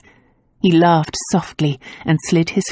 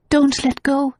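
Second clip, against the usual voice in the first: whimpering plea; 7 semitones higher than usual. A narrator's reading. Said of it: "she whimpered".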